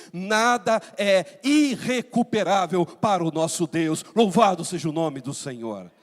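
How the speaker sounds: loud, slow, weighty proclamation; forceful preaching. Acted. Pastor assuring families God can restore, preaching forcefully. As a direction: proclaim it word by word, then praise.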